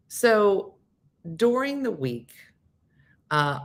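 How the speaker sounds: usual speaking voice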